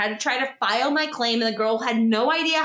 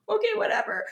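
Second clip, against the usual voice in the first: high, defeated okay, whatever; resigned whatever; 10 semitones higher than usual. Retold or imitated. Answering the attendant about mailing the battery, resigned.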